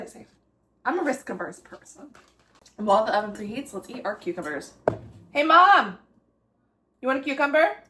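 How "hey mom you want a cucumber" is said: loud call across the house. Real, not acted. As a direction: shout to someone in another room. Calling to mom in another room.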